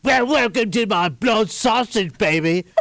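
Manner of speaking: hammed-up British greeting; attempted British accent. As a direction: drawl a welcome as a sleazy British barman. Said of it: "That's a British accent".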